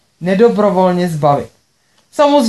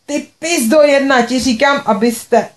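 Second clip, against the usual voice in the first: angry insult; 6 semitones higher than usual. Acted. Sister swears on the phone.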